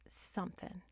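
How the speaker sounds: a sudden breathy whisper; whispered aside. Real, not acted. Whispering an aside mid-rant to her viewers. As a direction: drop to a whisper for one word.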